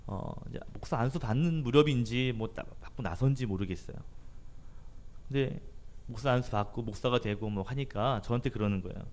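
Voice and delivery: ordinary talk, nothing marked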